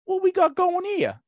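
high-pitched cockney bobby voice; British bobby. Acted. Bobby strolls up to a police siege, hammy British accent. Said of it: "He's just like".

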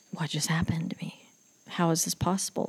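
stunned, unvoiced-soft whisper to oneself; shaken, stunned reaction. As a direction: whisper it in disbelief, acting out the shock. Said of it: "I was shook".

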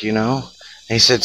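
ordinary talk, nothing marked

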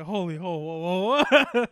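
loud, high mock alarm; a loud startled whoa; startled bartender. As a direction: back off startled, laughing. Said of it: "Bartender's like".

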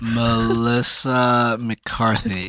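Melissa McCarthy stretched very slowly; drawn out. Acted. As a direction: drag out the name slowly. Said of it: "drawn-out name repeat".

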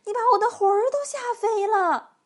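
high, loud panicked squeal; scared-out-of-her-wits old lady; frightened cry. A narrator's reading. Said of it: "瘦小的老妇人喊起来".

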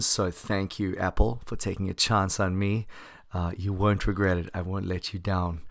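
ordinary talk

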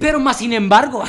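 loud quote bursting into laughter; laughing mock quote. Mocking a common phrase, laughing loudly. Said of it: "No te quedas de acuerdo cuando dicen".

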